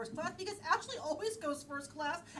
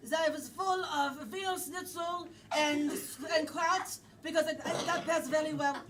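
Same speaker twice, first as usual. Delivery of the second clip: put-on German accent; 3 dB louder than usual. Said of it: "German endowment".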